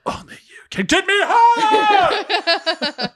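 high, slow, dramatic yell; whisper yell. Retold or imitated. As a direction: belt it out like a movie climax. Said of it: "whisper yell it like Jupiter ascending".

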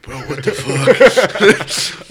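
slow, high, laughing what the; laughing disbelief. Retold or imitated. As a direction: drag it out through laughter. Boss reacting, laughing in disbelief.

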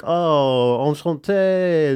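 slow, drawn-out French charm; seductive French accent. Retold or imitated. A Frenchman wooing a shy woman, slow French accent. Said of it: "talk a little bit with a French accent".

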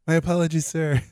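mock formal apology.

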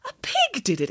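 high, astonished question; shocked exclamation. A narrator's reading. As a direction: gasp it out in disbelief. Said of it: "exclaimed Nanny Piggins".

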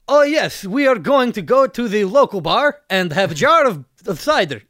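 mock Eastern European accent. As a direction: play an earnest Eastern European man in broken English. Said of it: "Eastern European guy who".

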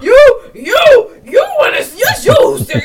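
loud, high-pitched mock tirade; going in, mimicked. Imitating partner going zero to sixty over stinking, mock outrage. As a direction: fire back accusations in an exaggerated outburst. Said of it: "he goes in".

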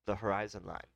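usual speaking voice

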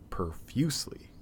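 whispered aside. Real, not acted. Wife went on about it.

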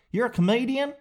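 high-pitched Southern questioner; Southern accent. Acted. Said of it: "why they're all as Southern".

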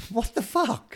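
high, startled exclamation; shocked. Real, not acted. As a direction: blurt it out in disbelief. Reacting to chainsaw scene.

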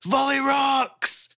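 high, slow, loud cheering shout. Acted. Volley shouting his own name after the sprites, long cheer. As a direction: belt it out proudly. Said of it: "he's gonna shout".

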